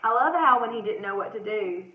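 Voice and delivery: ordinary delivery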